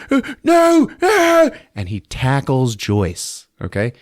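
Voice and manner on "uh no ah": a very high, loud acted cry of no; hammed-up shout of alarm; mock yell. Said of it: "Colonel Obi-Wan's like".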